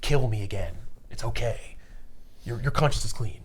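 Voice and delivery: low, soft, halting farewell; Mitchell. Acted. Real Mitchell releasing Jim from guilt, quiet farewell. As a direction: speak gently and low, pausing between phrases. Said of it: "real Mitchell, like, emerges".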